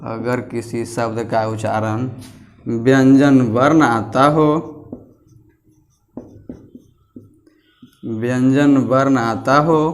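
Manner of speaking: slow, spaced-out dictation pace with long pauses. Acted. Teacher dictating notes to his class, slow and deliberate. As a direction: read each phrase slowly so pupils can write it down.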